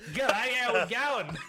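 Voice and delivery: loud mock Welsh that lands in Australian; botched Welsh accent. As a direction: proudly announce a Welsh accent, then go broad Aussie. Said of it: "Listen to my Welsh accent".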